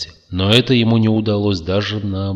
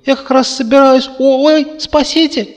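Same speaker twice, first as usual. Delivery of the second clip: очень высокий громкий писк; панически запищать голосом зверька; перепуганный зверёк; 9 dB louder than usual. A narrator's reading. Зверёк зовёт на помощь. (отчаянный писк пушистого зверька)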